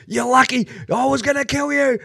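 high mocking threat; mock tough guy. Mocking the bloodied driver's threat from his car, high and hammed. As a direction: ham up the bloodied guy's bluster.

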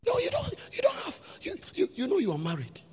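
sudden high shouted rebuke; mock scold. Preacher scolding a married person on a date, shouted. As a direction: cut them off sharply, shouting.

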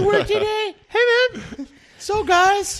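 squeaky high-pitched voice. Pretend. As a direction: jump way up into a squeaky falsetto. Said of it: "High pitch, [name]".

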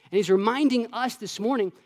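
usual speaking voice

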